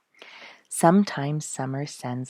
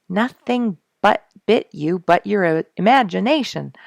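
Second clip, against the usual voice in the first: unsympathetic; 5 semitones higher, 7 dB louder than usual. A narrator's reading. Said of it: "without the least bit of sympathy".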